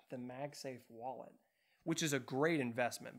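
usual speaking voice

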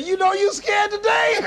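high laughing tease to himself; teasing self-talk. Retold or imitated. Speaker teasing himself backstage, laughing high. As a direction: poke fun at your own fear. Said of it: "I said".

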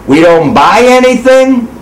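ordinary delivery

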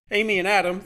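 high, loud call; shouted. Acted. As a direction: call them through the crowd. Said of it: "the woman shouted".

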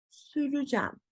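süreceğim as sü-rü-jem; spoken contraction. Shown as a demonstration. Teacher repeats the word, süreceğim as sürücem. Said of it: "böyle değil".

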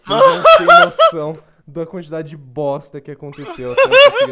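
loud, laughing exasperated shout. Real, not acted. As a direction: yell it while laughing. Laughing rant to audience.

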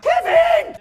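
very high, loud yell of the name; excited shout. Real, not acted. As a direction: scream it like a fan. Hoping to pack a player.

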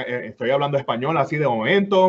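switching into Spanish. Shown as a demonstration. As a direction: slip into casual Puerto Rican Spanish. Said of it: "but the accent come back".

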